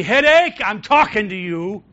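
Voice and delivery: full-voiced rebuke; commanding shout. Shown as a demonstration. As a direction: shout it straight at the headache, high and loud. Commanding the sickness aloud.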